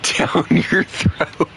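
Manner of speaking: whispered through laughter, high and slow; laughing mock threat. Streamer voicing a British viewer's silly threat, laughing. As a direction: giggle out a fake threat.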